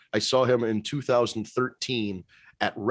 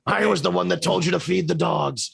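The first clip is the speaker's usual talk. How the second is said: higher, hammed-up reveal; mock villain confession; 6 semitones higher than usual. Wrestler confessing to an old plot, mock villain voice. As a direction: declare it like a pitched-up wrestling villain.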